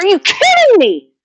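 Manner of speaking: high, loud exasperated holler; retold hollering. Fed up with the fence, a high loud holler. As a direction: yell it up high, at the end of your rope. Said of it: "hollering about what's going on".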